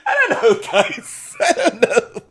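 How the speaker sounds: words laughed out high; laughing. Real, not acted. Laughing while trailing off about the romance. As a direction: giggle through it. Laughing through her point.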